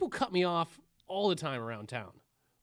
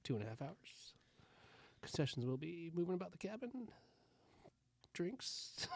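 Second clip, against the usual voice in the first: pilot voice; 2 semitones lower, 13 dB quieter than usual. Acted. Pilot announcement parody.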